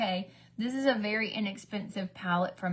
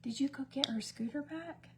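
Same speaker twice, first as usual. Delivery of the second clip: whispered question off to the side; 5 semitones higher, 6 dB quieter than usual. Real, not acted. Asking her husband off camera, whispered. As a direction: ask it under the breath, unvoiced.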